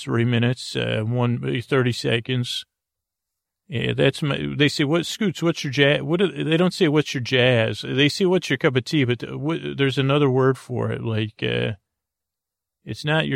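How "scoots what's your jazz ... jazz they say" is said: ordinary talk, nothing marked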